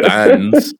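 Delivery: bands with a strange accent, high and rising; accidental accent on Bands; odd accent. Real, not acted. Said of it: "are you trying to do an accent?".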